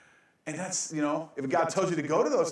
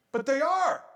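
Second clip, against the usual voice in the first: high, loud teasing aside; cheeky punchline; 11 semitones higher, 5 dB louder than usual. Real, not acted. Preacher jokes that arguers are dummies, cheeky. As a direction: toss it off as a joke. Comic aside on calling people dummy.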